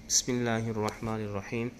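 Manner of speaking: measured devotional recitation; recitation opening. Acted. Teacher opening the Quran reading lesson, recited. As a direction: begin the lesson with a recited bismillah. Recites before Qaida drill.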